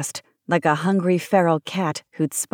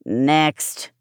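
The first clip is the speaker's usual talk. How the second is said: low smoker's growl; rough, curt smoker's voice; two-packs-a-day growl; 3 semitones lower than usual. A narrator's reading. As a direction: growl it like a chain-smoking pawnbroker. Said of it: "growled the woman behind the cage in a two packs a day camel's voice".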